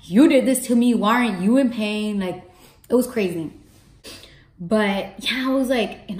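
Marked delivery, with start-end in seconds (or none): 0.0-2.2 attitude. Retold or imitated. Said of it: "I was giving everyone attitude".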